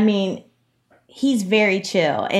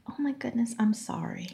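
tiny, meek whispered apology, imitating a child; scolded child; 3 semitones higher, 12 dB quieter than usual. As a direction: shrink into a very soft, hammed-up apology. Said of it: "like one of our children getting scolded".

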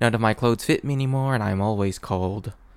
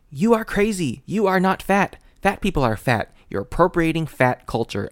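mock outraged friend; 7 semitones higher, 3 dB louder than usual. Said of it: "And then they're like".

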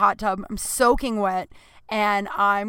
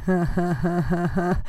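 creepy mock laugh; 3 semitones lower than usual. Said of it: "laughing to myself".

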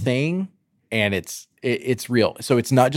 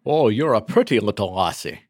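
playful mock Scottish accent, Connery style. Sean Connery tribute, a jokey hammed-up Scottish accent. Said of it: "I don't even know if that was Scottish".

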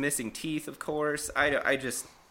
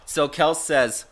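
usual speaking voice

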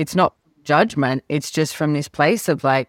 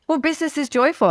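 insistent; 12 semitones higher, faster than usual. Retold or imitated. Insisting to Gary on joy.

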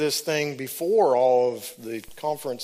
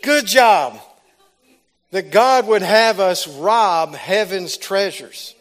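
slow, loud, higher booming divine voice; voice of the Lord; 5 semitones higher, 7 dB louder, much slower than usual. Retold or imitated. Preacher voicing God to the congregation, slow loud proclamation. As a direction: proclaim it like God praising his people. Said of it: "And the Lord's like".

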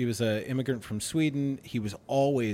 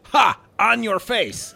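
higher mock-Swedish taunt; angry Swede catchphrase; 8 semitones higher, 5 dB louder, slower than usual. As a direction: crow it like a cocky Swedish coworker. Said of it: "He would always say".

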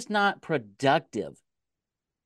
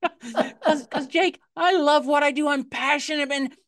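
laughing; 9 semitones higher than usual. Real, not acted. Laughs, then speaks passionately.

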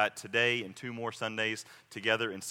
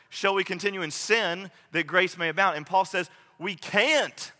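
higher and louder, driving the point home; fervent pulpit preaching, louder and higher; preaching at full force; 5 semitones higher, 5 dB louder than usual. Acted. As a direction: preach it big, building to a pounding climax. Preaching climax on sanctification.

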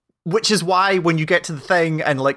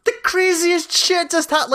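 much higher, slow, put-on amazed voice; mock amazed; 13 semitones higher than usual. Player mocks the absurd scene, high mock amazement. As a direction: gawp at it in a silly high voice. Mocking player's stunned reaction.

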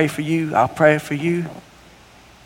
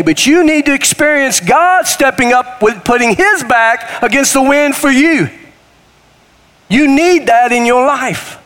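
preaching; 7 semitones higher than usual. Acted. Preaching at full force.

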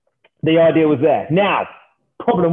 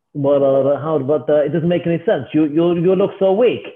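shaky attempt at a Russian accent, slightly raised; put-on, muddled Russian accent; bad Russian accent. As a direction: imitate a Russian guy, accent slipping. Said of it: "This accent's getting a little bit worse".

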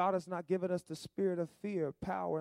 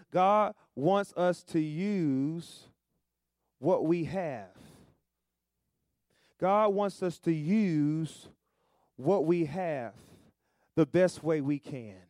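slow emphatic preaching; 6 dB louder, much slower than usual. Acted.

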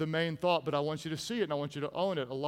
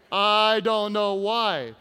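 loud, slow, drawn-out repeat; mock bafflement; 6 dB louder, slower than usual. Real, not acted. Preacher on women laughing nearby, loud mock bafflement. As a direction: stretch it out in feigned puzzlement.

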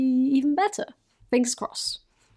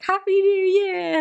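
bright, much higher, louder New Year shout; cheery greeting; 10 semitones higher, 5 dB louder than usual. Retold or imitated. Friends at the door greeting her with excitement. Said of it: "and they were like".

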